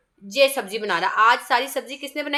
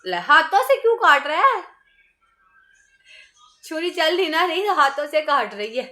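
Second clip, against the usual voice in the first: playful scolding; 5 semitones higher, 4 dB louder than usual. Real, not acted. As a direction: tease her for using her hands. Mother scolds child cutting with hands. (बच्ची को प्यार से डाँटते हुए ऊँची आवाज़ में)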